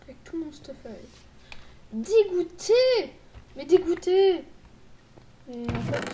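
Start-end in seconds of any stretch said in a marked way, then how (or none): 1.9-4.4 loud disgusted cry; disgusted shout. Real, not acted. Player losing all gear, shouting in disgust. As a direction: yell your frustration. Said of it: "je savais que j'étais dégoûté".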